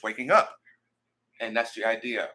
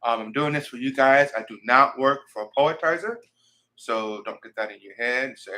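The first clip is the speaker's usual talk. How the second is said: normal talking voice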